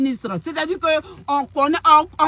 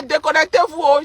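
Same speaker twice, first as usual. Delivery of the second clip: ordinary talk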